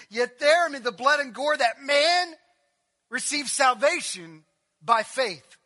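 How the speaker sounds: loud, driving sermon cadence; preaching at full force. Acted. Pastor stirring the congregation, full force preaching. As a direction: hammer each phrase to the congregation. Builds to the crucifixion climax.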